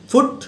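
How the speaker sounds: "ফুট" with a short, quick u; short u sound. Shown as a demonstration. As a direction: keep the vowel of "ফুট" brief. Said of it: "short উ sound".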